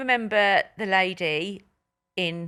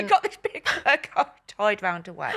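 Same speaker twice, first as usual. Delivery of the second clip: laughing; 3 semitones higher than usual. Real, not acted. Laughing at the fur coat story.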